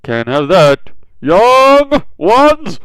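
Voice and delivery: put-on a hero bellowing to protect the kids; hammed-up All Might yell; heroic shout. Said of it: "and All Might's like".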